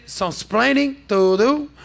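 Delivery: slow, high, loud mock sitcom line; catchphrase quote. Host teasing Reuters with a borrowed slow, loud catchphrase. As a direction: draw out the catchphrase and lean into it. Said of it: "as they say".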